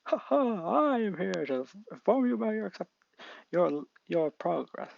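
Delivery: high, booming hero voice; All Might. Acted. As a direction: announce it like a proud hero. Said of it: "All Might appears, saying".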